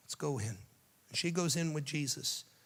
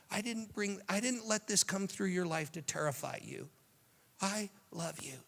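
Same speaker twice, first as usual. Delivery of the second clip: tender comfort; 6 semitones higher than usual. Acted. Jesus comforting a frightened believer.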